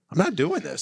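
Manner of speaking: exasperated quote of cops quitting; fed-up refusal. Retold or imitated. Quoting fed-up cops who retire early.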